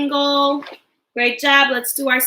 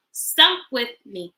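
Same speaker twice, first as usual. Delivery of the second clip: normal talking voice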